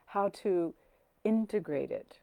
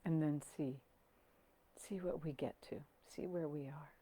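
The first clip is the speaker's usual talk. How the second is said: soft, unhurried meditation voice with long pauses; hushed, settling calm; guided meditation; 5 dB quieter than usual. Acted. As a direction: guide them gently down into the body.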